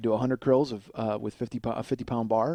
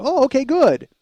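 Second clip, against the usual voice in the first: very high, loud acknowledgment; exaggerated anxious relief; 11 semitones higher, 7 dB louder than usual. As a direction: overdo the eager reply, pitched way up. Repeats his reply, more exaggerated.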